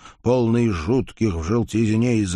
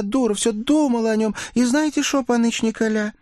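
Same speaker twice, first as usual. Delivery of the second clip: put-on high, lively girl's voice; girlish young woman voice; 10 semitones higher than usual. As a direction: play a coy village girl. Oksana voiced by narrator.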